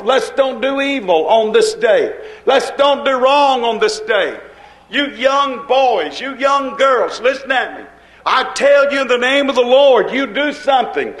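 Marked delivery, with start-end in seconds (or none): none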